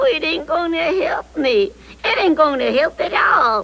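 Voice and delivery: high, tearful wail; whining protest. Acted. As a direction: moan that all is hopeless. Puppet despairs at the suggestion.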